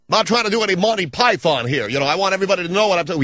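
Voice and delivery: high, fast cartoon voice; quick, high mocking voice; Comb Over Boy voice. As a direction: rattle it off in the silly voice. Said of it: "a voice like it's completely out of a cartoon".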